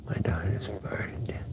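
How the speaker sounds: hushed, unvoiced aside; whispered aside. Real, not acted. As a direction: whisper it under your breath.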